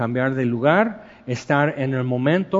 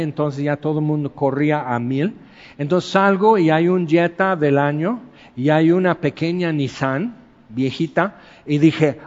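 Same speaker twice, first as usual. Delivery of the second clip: ordinary talk, nothing marked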